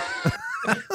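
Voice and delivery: high squealing laugh; burst of laughter. Real, not acted. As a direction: crack up at the impression. Laughing at own impression.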